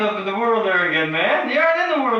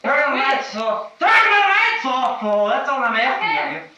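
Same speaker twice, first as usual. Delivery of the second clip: louder and higher with each plea; rising shout; 10 semitones higher, 6 dB louder than usual. Real, not acted. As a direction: yell it, losing control. Escalating plea to kill lights.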